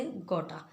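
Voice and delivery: गोटा, got to merged into one word ending in a; casual spoken gotta; reduced gotta. Shown as a demonstration. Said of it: "इसे हम pronounce करते हैं गोटा".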